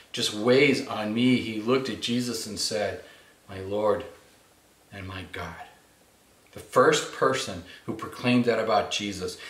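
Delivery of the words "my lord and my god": low, very soft confession; hushed reverence. Acted. Thomas looking at Jesus as he believes, hushed and reverent. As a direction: drop to an awed hush, with pauses.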